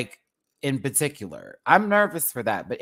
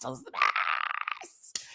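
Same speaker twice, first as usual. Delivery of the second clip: breathy slow whisper of delight; 6 dB quieter, much slower than usual. Real, not acted. Savoring breakfast, whispering to chat. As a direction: hush it out slowly like a secret.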